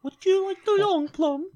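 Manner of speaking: high, slow mock English voice; drawn-out, high-pitched comic British voice; mock English accent. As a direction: ask it as a high English caricature. Joke in put-on English voice.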